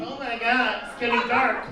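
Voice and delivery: normal talking voice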